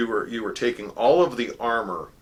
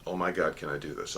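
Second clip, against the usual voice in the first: anxious self-doubt; 4 semitones lower, 5 dB quieter, faster than usual. Retold or imitated.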